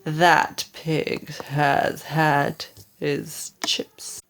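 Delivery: low and slow, savouring the thought; a hungry wolf licking his lips; mild menace, slow and low; low, slow gloating wolf. Acted. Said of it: "licked his lips and said".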